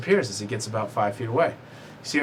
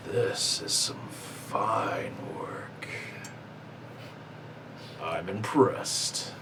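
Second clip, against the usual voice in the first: blacksmith admiring; 3 semitones lower, 9 dB quieter, much slower than usual. Acted. Blacksmith NPC admires axe.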